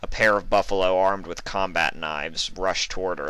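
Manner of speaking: ordinary delivery, nothing marked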